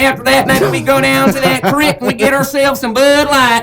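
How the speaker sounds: hammed-up country voice. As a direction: sell the creek and beer plan, country style. Joint country voice bit.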